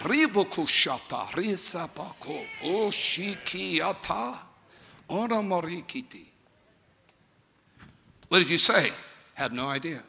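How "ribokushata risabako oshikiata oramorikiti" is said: speaking in tongues. Shown as a demonstration. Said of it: "so I can enter into that".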